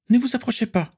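high-pitched, alarmed cry; cried warning. A narrator's reading. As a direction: cry out to keep them back, pitched high. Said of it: "cria-t-il".

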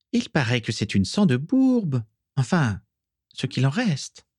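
high-pitched, haughty contempt; lightly sniffed scorn; disdainful. A narrator's reading. Isalia gossiping about Mimi's blood, disdainful. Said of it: "renifla Isalia avec dédain".

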